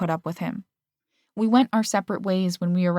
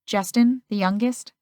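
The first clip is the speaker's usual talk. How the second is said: usual speaking voice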